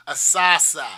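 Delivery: Boston accent, saucer as "salsa". Shown as a demonstration. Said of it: "You want me to use my Boston accent?".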